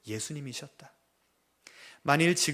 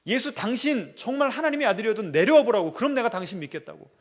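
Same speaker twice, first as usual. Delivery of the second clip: mocking taunt; 6 semitones higher, 10 dB louder than usual. Mockers at the cross taunting.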